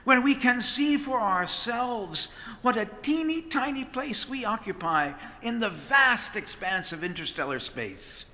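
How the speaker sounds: usual speaking voice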